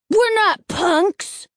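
high, loud, slow retort; angry shout. A narrator's reading. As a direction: shout back in anger. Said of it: "the heavier boy shouted angrily".

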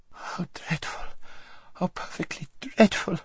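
high, hushed, breathy whimper; frightened whimper. A narrator's reading. Frightened girl to her friend in the dark, whimpering. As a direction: whimper it softly in dismay. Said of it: "whimpered Lazareline".

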